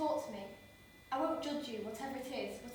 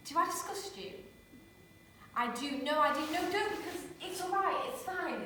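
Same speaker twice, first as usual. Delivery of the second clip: higher, breaking into hurt; flustered hurt; 5 semitones higher than usual. Acted. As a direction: scramble to take it back.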